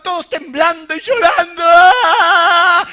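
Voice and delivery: loud, drawn-out comic wail; mock terrified wail. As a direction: wail like frightened disciples, hammed-up. Imita el llanto aterrado de los discípulos. (lamento exagerado de miedo)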